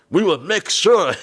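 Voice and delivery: high, loud, drawn-out hammy vow; overacting guard. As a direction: wildly overplay the security man. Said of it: "One of the guys was overacting".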